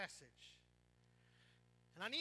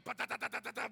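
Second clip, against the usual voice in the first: bouncing noise imitation; 9 dB louder, faster than usual. Mimicking bouncing banana ride.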